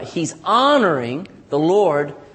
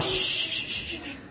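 urgent whispered shushes; imitated shushing; 8 dB quieter than usual. Preacher imitating people hiding from a knock, shushing. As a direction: shush like someone hiding behind the door. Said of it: "you'd hear somebody going".

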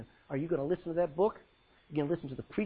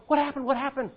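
higher, louder confused asking; bewildered people; 8 semitones higher, 5 dB louder than usual. Retold or imitated. Churchgoers left behind after the rapture, bewildered. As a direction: voice the left-behind looking around lost. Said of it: "the people look around going".

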